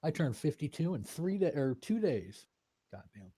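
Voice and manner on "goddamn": ordinary delivery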